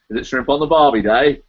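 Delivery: badly faked Australian accent; jokey, broad attempt at Australian; terrible Australian accent. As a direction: put on a bad Aussie accent. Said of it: "That was a terrible Australian accent".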